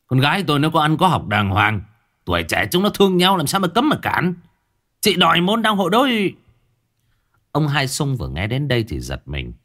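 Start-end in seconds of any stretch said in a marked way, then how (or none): 0.1-6.3 much higher and louder indignant defense; offended. Acted. Girl's father taking offense at the mother, indignant. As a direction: bristle at the insult to your daughter. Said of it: "Ông Bảy Cò nhíu mày".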